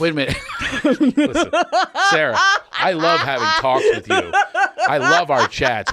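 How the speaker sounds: loud, high, through laughter; laughing protest. Real, not acted. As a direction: protest while cracking up laughing. Cracking up in mock protest.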